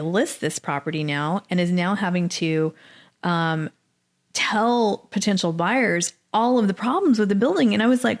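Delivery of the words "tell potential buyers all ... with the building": usual speaking voice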